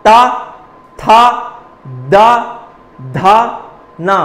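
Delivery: ता था दा धा ना sounded one at a time with pauses; letter drill; dental syllable demo. Shown as a demonstration. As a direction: say each dental sound crisply, separately. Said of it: "तो दंत जैसे बोलिए आप".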